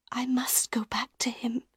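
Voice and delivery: high, soft whisper; whispered resignation. A narrator's reading. Christine whispering to Raoul she must return. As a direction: give in under her breath.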